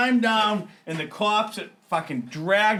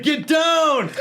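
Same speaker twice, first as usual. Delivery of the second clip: high, loud shout up to the roof; 12 semitones higher, 6 dB louder than usual. Retold or imitated. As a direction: yell at him to get down. Friend shouting up.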